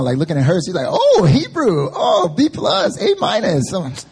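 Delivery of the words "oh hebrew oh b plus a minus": high, drawn-out crowing over grades; loud mock gloating. Colleague reading out top grades nearby, loud mock gloating. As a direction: imitate a colleague's loud, slow delight, pitched way up. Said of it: "Why you gotta talk so loud".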